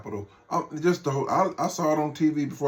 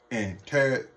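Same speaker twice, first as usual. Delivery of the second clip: ordinary talk, nothing marked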